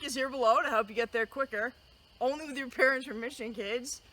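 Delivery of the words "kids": usual speaking voice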